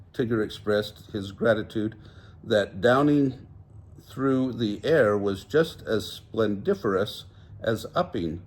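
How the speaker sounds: usual speaking voice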